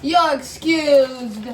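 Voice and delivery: slow, loud mock-formal reply. Real, not acted. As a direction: stretch it out grandly. Answers a burp excuse.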